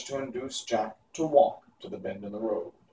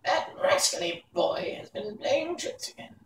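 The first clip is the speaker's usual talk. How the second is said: high crone voice, slow menacing very good; angry old witch; 11 semitones higher, 4 dB quieter than usual. A narrator's reading. Mombi threatens the boy who tried to scare her.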